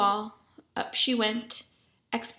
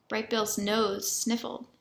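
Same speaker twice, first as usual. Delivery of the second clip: ordinary delivery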